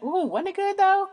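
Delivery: high, sassy cooing voice, rising at the end; squealed high mock voice savoring the memory. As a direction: coo it like a smug girlfriend teasing. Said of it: "It's like".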